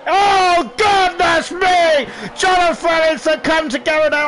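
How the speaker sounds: high, loud, drawn-out gasp of surprise; shocked exclamation. Real, not acted. Commentator stunned by a sudden collision, loud shock. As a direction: blurt it out as the crash happens.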